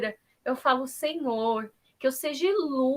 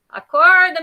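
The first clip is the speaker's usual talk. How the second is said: much louder, sharp shout of Acorda; loud wake-up shout; 3 semitones higher, 10 dB louder than usual. Real, not acted. Shouting at sleeping Wellington to wake up, loud. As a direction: bark Acorda to jolt him awake. Calling a sleeping participant awake.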